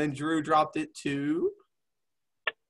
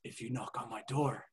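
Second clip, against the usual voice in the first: soft, low mock Dutch accent, halting; quiet menace in a put-on Dutch accent; [name]; 7 semitones lower, 12 dB quieter than usual. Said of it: "now we get a Dutch accent".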